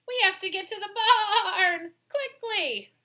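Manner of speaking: high, loud and panicky; panicked sheep voice; frightened. Acted. Frightened sheep urging flight.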